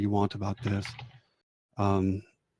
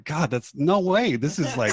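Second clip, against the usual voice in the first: disbelief; 5 semitones higher, 4 dB louder than usual. Acted. Acting her disbelief at ceiling height.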